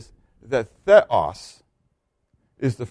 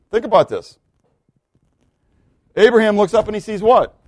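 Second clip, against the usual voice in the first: high, urgent sermon storytelling; emphatic preaching; 5 semitones higher than usual. Real, not acted. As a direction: preach it insistently, pitch held high.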